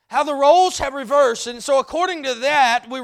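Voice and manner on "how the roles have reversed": louder ringing repeat; loud preaching repeat; proclaimed. Real, not acted. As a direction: declare it to the room. Loud repeat of the whispered line.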